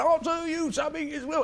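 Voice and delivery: a hint of a preachy alternative comedian; mock political comic. Retelling his young self on stage in Liverpool, mock earnest. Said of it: "I was in Liverpool going".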